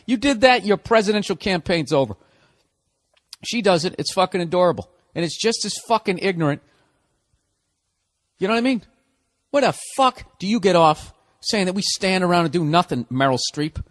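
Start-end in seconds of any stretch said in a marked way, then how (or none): none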